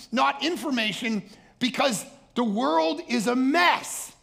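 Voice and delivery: high, loud, slow preaching. Acted. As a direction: drive it home slowly from the pulpit. Preaching at full force.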